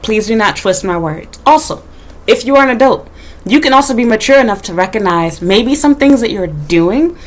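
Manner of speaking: ordinary talk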